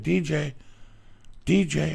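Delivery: high, loud call to a cat across the yard. Retold or imitated. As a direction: sing out his name to bring him running. Calling the cat in the morning.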